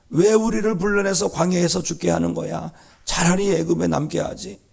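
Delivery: mildly acted grumbling, pitched high; high-pitched mock protest; resentful complaint. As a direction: complain as an aggrieved crowd would. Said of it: "사람들이 원망을 합니다".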